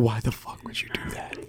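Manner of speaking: a hushed aside, kept off the call; breathy whispered disbelief. Real, not acted. Prank caller whispering to the camera, away from the phone.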